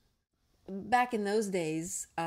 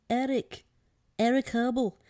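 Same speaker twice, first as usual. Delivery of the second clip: higher, louder calling voice; little voice calling by name; small little voice; 5 semitones higher, 7 dB louder than usual. A narrator's reading. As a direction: call out as a tiny creature. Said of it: "a small little voice calling him by name".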